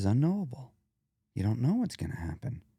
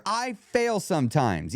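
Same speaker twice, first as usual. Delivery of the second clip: slower, less harsh admission; 6 semitones higher, slower than usual. Shown as a demonstration. Showing listeners the difference, less harsh admission. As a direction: say it slowly, leaving wiggle room. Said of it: "one of them sounds harsher than the other".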